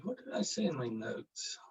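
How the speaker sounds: whispered to himself, looking for his notes; whispered aside. Real, not acted. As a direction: whisper it to yourself. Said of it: "whispering to himself".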